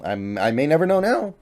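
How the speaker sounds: real voice break mid-sentence; voice crack. Real, not acted. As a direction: voice cracks up high on now. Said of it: "Voice crack".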